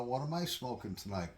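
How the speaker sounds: usual speaking voice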